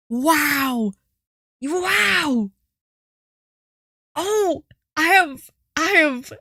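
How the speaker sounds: high, breathless, smitten wow; swooning. Real, not acted. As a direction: swoon over him. Swooning over the character.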